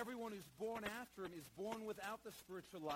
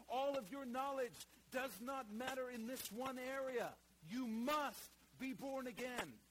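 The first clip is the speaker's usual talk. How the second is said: higher, louder and slowing, building to a peak; preaching at full force; 7 semitones higher, 6 dB louder than usual. Acted. Preacher pressing Nicodemus that nothing else counts, rising to a slow loud climax. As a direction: drive it home like a revival preacher.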